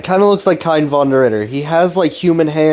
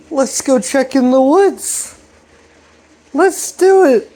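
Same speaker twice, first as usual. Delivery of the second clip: much higher, slow and drawn out; eager boys voice; 11 semitones higher, slower than usual. Retold or imitated. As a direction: voice the boys, pitched way up. Boys voiced in retold scene.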